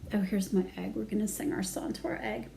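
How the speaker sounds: ordinary delivery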